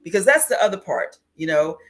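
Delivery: normal talking voice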